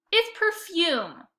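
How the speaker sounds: put-on high, slow whiny girl; whining. A narrator's reading. Lexi whining back at her brother about perfume. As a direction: drag it out in a whine. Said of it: "she whined".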